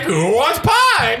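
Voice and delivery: high, drawn-out mock holler; excited call-out. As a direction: stretch the offer out in mock excitement. Acting winner screaming to the diner.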